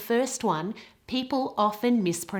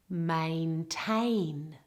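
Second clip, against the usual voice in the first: "Maintain" drawn out, weight on TAIN; careful teacher's "Maintain", stressed at the end; stress on second syllable; 3 semitones lower, 4 dB quieter than usual. Shown as a demonstration. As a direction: model "Maintain" with two full A vowels, the second stressed. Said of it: "the emphasis and stress is all on the second".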